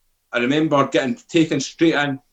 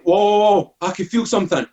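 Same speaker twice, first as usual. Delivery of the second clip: mock astonishment, pitched up; startled realisation; 6 semitones higher than usual. Patient first feeling the morphine, retold startled realisation.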